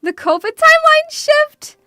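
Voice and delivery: loud, high-pitched mock outcry; scandalized high exclamation; mock shock. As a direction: gasp it out in exaggerated disbelief. Mock shock after a gasp.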